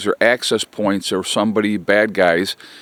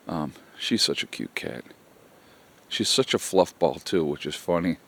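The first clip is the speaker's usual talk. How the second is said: much softer and lower, fond murmur about the cat; soft aside; 5 semitones lower, 9 dB quieter than usual. Real, not acted. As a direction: say it under your breath, fondly.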